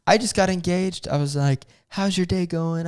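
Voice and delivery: high, friendly chit-chat voice; super nice. Retold or imitated. Newly engaged guy chatting up the check-in lady, super nice. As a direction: put on sweet small talk. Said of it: "super nice".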